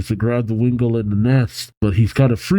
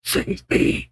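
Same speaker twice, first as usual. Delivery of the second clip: softer breathy whispered plea; faint whisper; 5 dB quieter than usual. A narrator's reading. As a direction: whisper it faintly from far inside. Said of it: "hears the faint voice saying".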